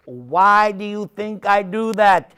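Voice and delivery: ordinary delivery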